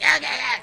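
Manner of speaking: high, loud repeated bleats; imitated clamor of work hitting at once; mock frantic yelling. Right back at work after the weekend, mock frantic.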